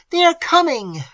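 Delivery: high, loud panic; terrified cry. A narrator's reading. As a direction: cry out as they approach. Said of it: "cried Madame Montoni".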